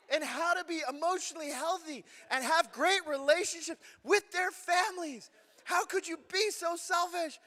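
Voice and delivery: high, loud furious rant. Retold or imitated. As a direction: scold the wife for being selfish.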